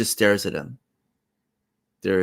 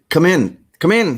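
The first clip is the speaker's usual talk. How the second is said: eager welcome; 9 semitones higher, 8 dB louder than usual. Acted. Host urging guest inside.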